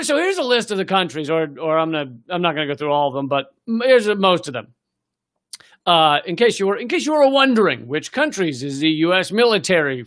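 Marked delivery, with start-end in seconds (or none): none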